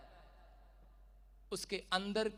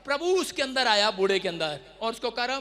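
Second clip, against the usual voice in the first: normal talking voice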